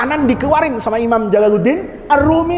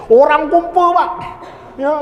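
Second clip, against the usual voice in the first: ordinary talk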